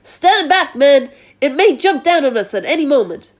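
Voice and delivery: high, loud alarmed command; police chief warning his men; yelled order. A narrator's reading. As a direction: shout the men back urgently. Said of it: "yelled the chief of police".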